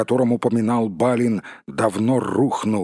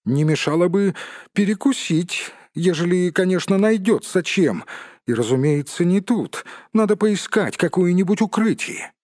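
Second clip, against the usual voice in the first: high-pitched hobbit voice with raised energy; 8 semitones higher than usual. Acted. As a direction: pipe up high as a hungry hobbit.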